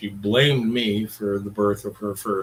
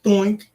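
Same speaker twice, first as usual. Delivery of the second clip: doink sound; 8 semitones higher, 6 dB louder than usual. Retold or imitated. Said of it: "If I just go".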